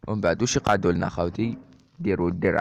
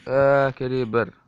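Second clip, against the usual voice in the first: drawn-out alarmed cry, louder and higher; panicked alarm; 5 semitones higher, 5 dB louder, much slower than usual. Real, not acted. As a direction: cry out as the creeper closes in. Creeper about to explode.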